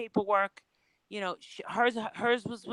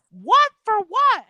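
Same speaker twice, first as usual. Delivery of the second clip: imitating a shocked friend, high and loud; high, loud double take, retold; shocked disbelief; 12 semitones higher, 11 dB louder, slower than usual. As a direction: squeal it out in mock shock. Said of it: "they were like".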